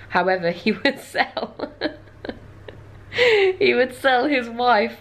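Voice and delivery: slowed by giggles; laughing through the words; real laughter. Real, not acted. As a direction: try to get it out while cracking up. Cracking up at the absurd offer.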